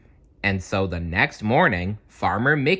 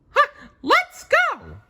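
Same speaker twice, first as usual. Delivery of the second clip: very high, slow cartoon mouse cry; Mickey voice; 5 dB louder than usual. Mickey urging his friends toward the castle. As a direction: call out eagerly in a squeak. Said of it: "cried Mickey".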